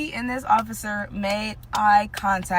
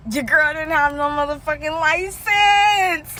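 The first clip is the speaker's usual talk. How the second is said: slow, loud, higher shout; dramatic shout; 6 semitones higher, 4 dB louder, slower than usual. Real, not acted. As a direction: hammer out the big reveal. Dramatic reveal to viewers.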